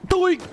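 high squeaky cartoon doink; a silly high bonk sound. Bonking head on log.

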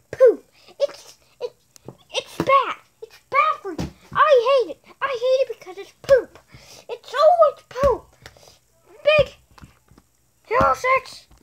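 excited rant. Real, not acted. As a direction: rant high and loud about it. Kids ranting at a movie.

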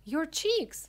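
high, loud alarmed cry; sharp storybook outcry; scream. A narrator's reading. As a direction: scream it as a panicking mother. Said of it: "screamed Missus Beauregard".

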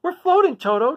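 high, loud amazed cry; Dorothy crying out. Acted. Dorothy to Toto in the twister, crying out in wonder. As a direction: call it out in breathless wonder. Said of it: "Dorothy cried".

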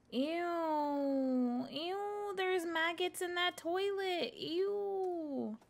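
high, squealing disgust; grossed out. Real, not acted. Streamer seeing maggots in a toilet, high squealing disgust. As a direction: recoil from the maggots.

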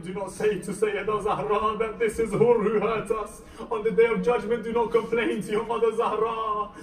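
high, loud, beseeching cry; acted entreaty at preaching force; impassioned retold plea. Retold plea to women for forgiveness.

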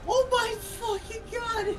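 high, drawn-out shocked cry; shocked shriek. Real, not acted. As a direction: shriek it in disbelief. Whole party lasered to death.

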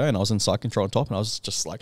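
ordinary talk

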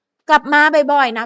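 mock fan calling after someone; imitated fans. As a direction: call it out slowly like an adoring fan. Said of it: "แถมแฟนๆบางครั้ง บ่อยเลยแหละ ชอบบอกว่า".

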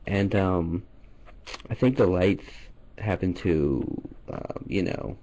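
deep mock-shy caller voice; low put-on voice. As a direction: drop the voice low and play embarrassed.

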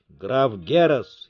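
usual speaking voice

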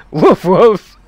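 loud high mock bark; dog bark. Streamer reading chat's joke impression as a bark. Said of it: "I'll do your impression of what I read".

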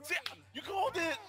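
high, loud laugh-cry; crying with laughter. Real, not acted. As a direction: laugh until it turns to tears. Howling with laughter at the call.

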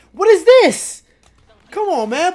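high, loud disbelief at the defense; fed-up outburst; exasperated yell. Real, not acted. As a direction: demand an answer from the screen. Frustrated by lazy defense.

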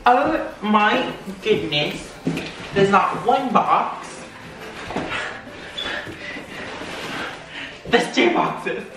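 slow, swooping amazed exclamations; hyper excitement. Real, not acted. Unboxing gifts and finding extra boxes, hyper amazement. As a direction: draw out each word in real excitement. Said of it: "too hyper for this right now".

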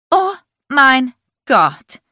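slow, high, loud gasped Oh mein Gott; a stunned cry she can barely hold in; shocked outcry. A narrator's reading. Aubrey realizing the secret, loud shocked outcry. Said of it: "ihren Aufschrei zu unterdrücken".

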